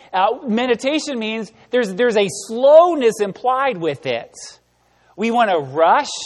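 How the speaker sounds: ordinary talk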